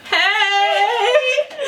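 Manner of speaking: hammed-up call for attention; mock loud hey. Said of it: "to get her attention".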